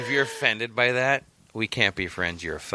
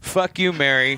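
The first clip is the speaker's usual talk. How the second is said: higher, louder mock-angry outburst; mock outrage; 6 semitones higher, 5 dB louder than usual. Friend fakes outrage at Mary's cereal post, loud. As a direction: playfully yell at the friend.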